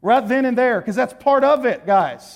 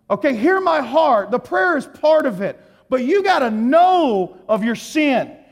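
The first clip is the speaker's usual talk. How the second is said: raised, urgent pulpit voice; evangelist preaching; 4 semitones higher, 4 dB louder than usual. Real, not acted. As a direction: drive the appeal home like an evangelist. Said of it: "I'm gonna be an evangelist for a minute".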